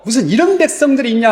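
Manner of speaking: fast, high, furious shout; angry outburst. Acted. Moses enraged at the people before striking, fast angry cry. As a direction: snap it out in anger. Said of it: "모세가 너무 화가 나가지고".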